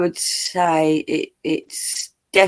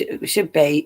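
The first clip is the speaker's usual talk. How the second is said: ordinary delivery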